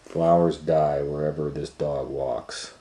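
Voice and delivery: ordinary delivery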